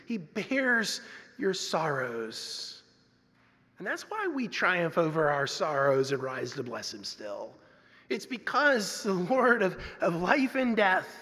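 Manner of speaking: usual speaking voice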